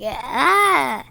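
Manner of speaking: sudden loud yawn shout; loud yawning cry. Real, not acted. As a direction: let the yawn burst out loud.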